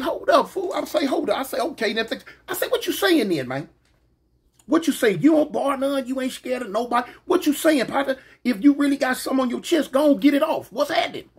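retold street challenge, pitched high; escalating challenge. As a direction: call him out, fired up and high.

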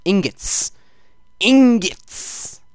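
rising to a loud high shout; escalating shout. Shown as a demonstration. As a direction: blast the word Ingots. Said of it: "really harsh on your ears".

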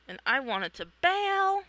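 usual speaking voice

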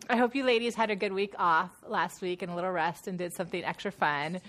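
ordinary talk, nothing marked

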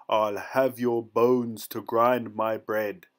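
loud, slow giant's threat; threatening giant. A narrator's reading. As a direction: boom it out as a menacing giant. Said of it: "But when he added".

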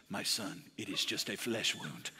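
low, hushed Jesus voice; hushed, solemn method-actor Jesus; Jesus in character. Retold or imitated. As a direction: soothe an injured follower as Jesus, very soft and low. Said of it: "he went into the character".

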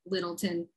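Littleton low and soft, ending reduced to "tin"; local pronunciation. Acted. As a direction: drop "Littleton" quietly as locals would. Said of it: "That's how they say it too".

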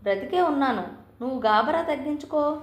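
ordinary delivery, nothing marked

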